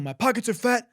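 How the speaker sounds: high, punched rap backup; emphasis double. Shown as a demonstration. Host demonstrating emphasis doubles, high punched delivery. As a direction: hit the whole phrase as hype vocal. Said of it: "what's called emphasis doubles".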